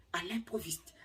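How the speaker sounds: breathy whisper of à l'improviste, unvoiced; secretive whispered repeat. Shown as a demonstration. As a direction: hush the words à l'improviste. Repeats phrase, then whispers it.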